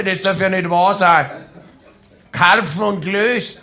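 lightly voiced giant dumpling, building to an outburst; talking dumpling with echo. Dumpling scolding the carp in the pot, booming indignantly. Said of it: "haben gesprochen mit Hall".

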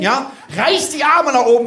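ordinary talk, nothing marked

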